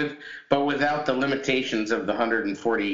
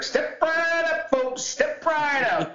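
snake oil seller; 11 semitones higher, slower than usual. Pretend. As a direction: shout like a carnival pitchman.

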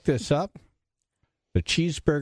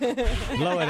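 high, laughing squeak; high mock voice; 11 semitones higher than usual. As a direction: squeak it out teasingly. Teasing about fingers.

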